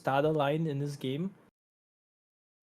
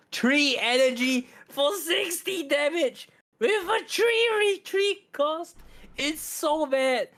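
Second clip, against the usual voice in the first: exaggerated outrage; 10 semitones higher, much slower than usual. Real, not acted. Mocking a bad card.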